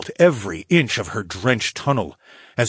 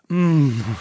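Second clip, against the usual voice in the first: moan; 6 semitones higher than usual. Acted. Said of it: "I moaned".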